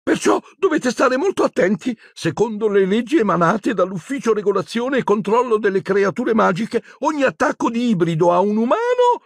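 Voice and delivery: high, haughty official voice; high, nervous bureaucratic warning; prim shrill Umbridge. Acted. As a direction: lecture them from on high. Umbridge lecturing centaurs.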